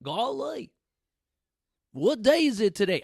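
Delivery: a dopey Southern yokel caught daydreaming; mock Southern accent. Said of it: "I don't wanna offend anyone that is from the South".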